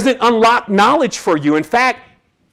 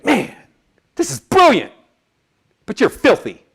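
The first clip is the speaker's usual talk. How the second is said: imitating the old doctor; 4 semitones higher than usual. Retold doctor Theo's verdict.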